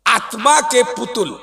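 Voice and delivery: ordinary delivery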